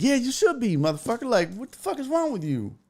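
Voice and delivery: high-pitched exasperated scolding; retold heated rebuke; indignant comeback. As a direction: throw the apology back at him. Retells rejecting the apology.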